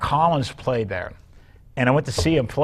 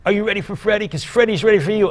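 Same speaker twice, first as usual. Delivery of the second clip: higher hype-man intro, pitched up; stage announcer hype; 7 semitones higher, faster than usual. Retold or imitated. Bass player introduces [name] onstage, higher hype call. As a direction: sell the star's entrance to the crowd. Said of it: "and he would go".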